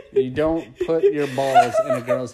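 loud, laughing through the words; helpless laughter breaking into the sentence. Real, not acted. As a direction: crack up while explaining it. Laughing while correcting a misunderstanding.

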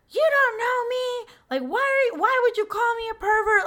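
high, loud, whiny mock outrage; hammed-up offended creep; indignant guy flipping out. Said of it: "He was like. flipped".

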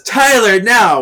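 loud, high command; urgent shouted order. Acted. Host voicing Piper signalling Tyler, urgent shout. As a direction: call it out sharply as a cue to act.

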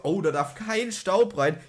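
high, slow mimicking voice; mocking quote. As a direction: parrot his warning mockingly. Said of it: "erst sagt er mir so".

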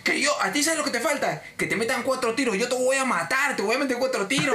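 high, escalating threats; ranting customer. Retold or imitated. Cliente que amenaza al agente, furioso.

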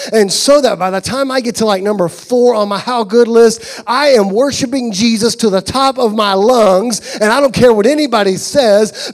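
loud, high, fervent sermon voice; climbing shouted sermon crescendo; full-force preaching. Acted. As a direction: preach it out to the whole room.

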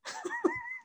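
very high quiet squeak; squeaky laugh. Real, not acted. Laugh at own rage.

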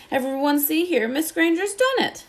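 excited tiny professor. Acted. Professor Flitwick praising Hermione to the whole class. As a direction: squeak with delight at the class.

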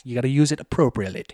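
ordinary talk, nothing marked

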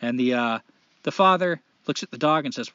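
ordinary delivery

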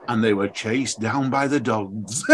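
low ominous voice, breaking into laughter; mock podcast narrator. Runner quoting the podcast again, low and laughing. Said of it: "And he goes".

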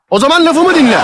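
high-pitched, indignant command; angry demand. Real, not acted. Caller orders the woman to listen, angry demand. Quarrel after being told not to shout.

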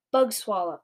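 usual speaking voice

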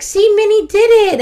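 high, loud mommy encouragement; excited cheering. Retold or imitated. Mom to toddler after the slide, excited cheering.

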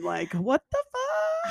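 high incredulous shout; shocked. Real, not acted. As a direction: blurt it out in disbelief. Shocked outburst at early arrival.

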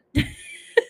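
unvoiced burst of laughter; breathy laugh break. Real, not acted. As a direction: crack up mid-line. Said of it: "That was good, huh?".

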